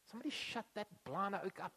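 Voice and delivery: hushed, hissed shush and complaint; the cool crowd hushing the blind beggar, quietly. Pretend. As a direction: shut him up under the breath. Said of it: "they're like".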